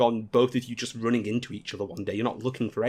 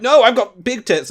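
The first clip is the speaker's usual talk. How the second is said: put-on higher and louder, slow flustered girl; embarrassed anime girl; 9 semitones higher, 8 dB louder than usual. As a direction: play the blushing anime girl. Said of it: "The anime girl will be like".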